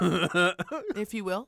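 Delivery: slow, knowing "if you will"; sly aside. Real, not acted. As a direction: drag it out with a wink. Sly aside after "altercation.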